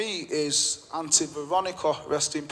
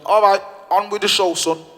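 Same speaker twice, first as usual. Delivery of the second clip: brave "on with the show"; 3 semitones higher, 7 dB louder than usual. Retold or imitated. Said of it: "she went".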